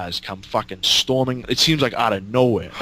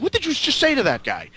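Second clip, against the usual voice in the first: much higher, panicked question; alarmed demand; 13 semitones higher than usual. Retold or imitated. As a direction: freak out at what he told the cop. Alarmed at Jake.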